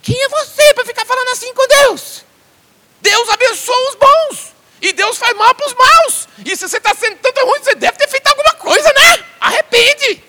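high, loud, slow rebuke; scolding friends. Retold or imitated. Job's friends rebuking him for speaking so to God, high and loud. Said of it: "Os amigos deles falaram assim".

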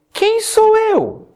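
very high, slow, drawn-out plea; self-pitying Moses. Acted. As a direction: stretch it out like a hopeless whimper. Moses doubting himself to God.

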